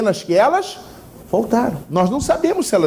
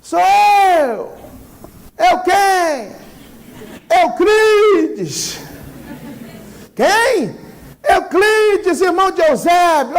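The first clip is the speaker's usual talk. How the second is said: shouting back; 9 semitones higher, much slower than usual. Acted. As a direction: yell your name to be let in. Visitor shouts his name.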